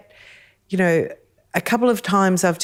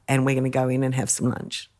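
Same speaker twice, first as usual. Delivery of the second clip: ordinary talk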